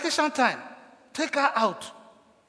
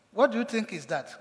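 ordinary delivery

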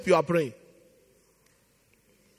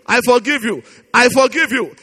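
ordinary talk, nothing marked

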